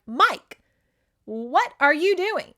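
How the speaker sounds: high, loud, drawn-out reproach; acted scolding. Family finds Mike at a brothel, acted scolding.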